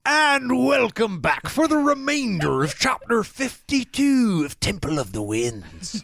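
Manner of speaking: slow, high-swooping mock Irish pirate accent. Host welcomes listeners back to the chapter, Irish-or-pirate accent. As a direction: put on a muddled Irish pirate brogue, drawn out. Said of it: "I don't know if it's Irish or pirate or what".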